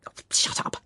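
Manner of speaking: voiceless mock snap at a colleague; whispered retort. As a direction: hiss it off playfully. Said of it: "I say".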